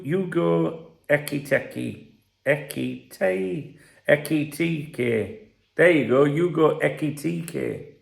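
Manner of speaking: slow, syllable by syllable "Hugo Ekitike"; careful name. Shown as a demonstration. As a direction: spell out "Ekitike" as e-ki-ti-ke, slowly. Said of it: "So he's called Hu".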